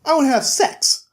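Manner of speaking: put-on high petulant kid whine; whiny little boy voice. Pinocchio complains to Beauty, high bratty whine. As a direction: whine like a cranky boy stuck young.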